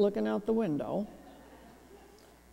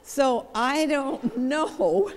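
laughing through the words, slowed; chuckling as she talks, drawn out; 4 semitones higher, 4 dB louder, much slower than usual. Real, not acted. Counselor laughing at her own joke to the audience, slow and laughing.

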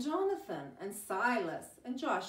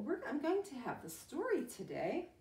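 ordinary delivery

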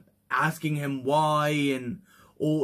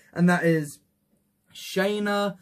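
ordinary delivery

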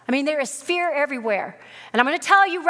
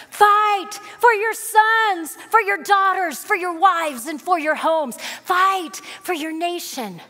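full-force preaching; 5 semitones higher than usual. Acted. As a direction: thunder it out to the congregation.

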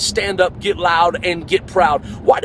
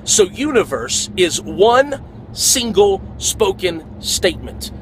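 universe is one single spoken statement, slowly, stress on one; drawn-out preacher emphasis; slow emphatic; slower than usual. Shown as a demonstration. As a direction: lean on each word slowly. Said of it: "Verse means a spoken statement".

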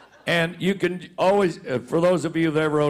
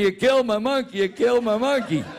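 mock cussing; 4 semitones higher than usual. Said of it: "cussing me".

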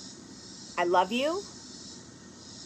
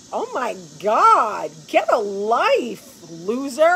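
put-on teen daughter; 5 dB louder, much slower than usual. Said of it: "Olivia was like".

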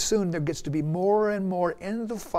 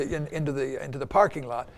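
ordinary talk

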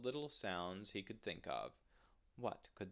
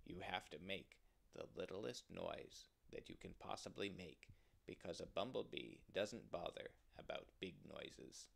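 hushed confiding; 2 semitones lower, 5 dB quieter than usual. Acted. Groundhog tells rabbit how to wake a bee, hushed. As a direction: confide it softly, like a secret. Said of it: "I will whisper to you".

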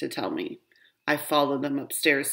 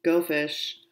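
ordinary delivery, nothing marked

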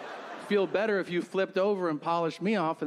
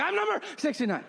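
high, fast, giddy boast; proud kid; 11 semitones higher, faster than usual. Retold or imitated. Kid boasting to the basketball team, giddy and fast. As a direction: brag it like an excited kid.

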